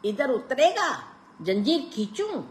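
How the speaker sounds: high, mocking Pathan; teasing. A narrator's reading. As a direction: tease him, then giggle. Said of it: "खी खी करके हंस दिया".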